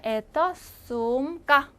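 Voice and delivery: 에떠 수움까 very slow with a long 우움; slow model reading. Shown as a demonstration. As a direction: drag out 에떠 수움까 so students catch each sound. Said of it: "제가 두 번씩 읽을 테니까".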